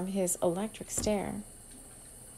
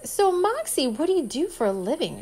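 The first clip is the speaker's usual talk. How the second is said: innocent; 7 semitones higher than usual. A narrator's reading. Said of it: "she said innocently".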